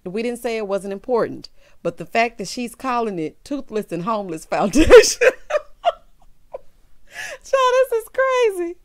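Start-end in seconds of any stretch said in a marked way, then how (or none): 3.4-5.2 louder as the laugh takes over; words dissolving into real laughter; breaking into laughter. Real, not acted. 5.3-5.9 high, breathless cackle; high-pitched wheezing laugh. Real, not acted. Cracks up at foundation name. 7.4-8.8 very high, slow squeal; high, drawn-out laugh-voice; recovering from laughter. Real, not acted. Speaking out of laughing fit.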